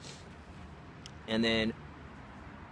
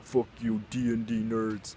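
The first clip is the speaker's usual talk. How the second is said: imitating a jock, slow drawn-out taunt; put-on meathead jeer, stretched out; hardcore jock; 3 semitones higher, slower than usual. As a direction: jeer slowly like a school jock. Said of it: "the hardcore jock was like".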